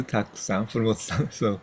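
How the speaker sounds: usual speaking voice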